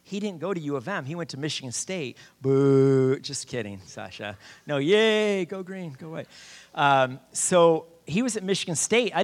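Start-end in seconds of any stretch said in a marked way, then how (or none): 2.4-3.2 loud, drawn-out playful boo; mock booing. Teasing about Michigan State, loud mock boo. Mock jeer at rival school. 4.6-5.4 high, loud playful yay; mock cheering. Making up for booing Michigan State, loud cheer.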